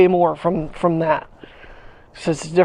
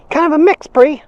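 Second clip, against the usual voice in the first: pet talk; 9 semitones higher, 4 dB louder than usual. Real, not acted. Repeating it to the dog.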